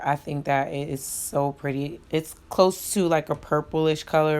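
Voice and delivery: usual speaking voice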